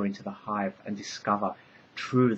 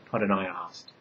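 ordinary delivery

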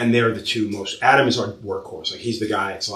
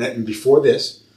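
usual speaking voice

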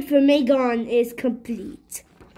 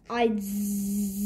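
dozing off; 5 semitones lower, 6 dB quieter than usual. Acted. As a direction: trail off into a low snore. Character drifts into snoring.

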